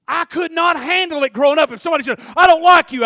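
high, loud pulpit shout; fired-up preaching. Real, not acted. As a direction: preach it at full volume. Preaching at full force.

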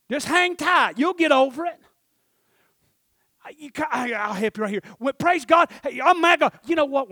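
high, loud, driving exhortation; full-force preaching. Real, not acted. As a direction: holler it out to the back pews.

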